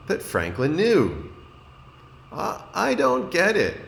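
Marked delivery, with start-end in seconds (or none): 2.3-3.8 put-on high, stammering child voice; a confused classmate; high halting kid voice; puzzled Beaver. A narrator's reading.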